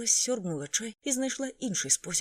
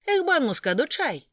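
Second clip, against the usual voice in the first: loud, much higher triumphant shout; 9 semitones higher, 9 dB louder than usual. A narrator's reading. Waiter Atif shouts the order in triumph, loud and high.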